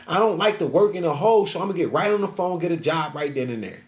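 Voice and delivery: normal talking voice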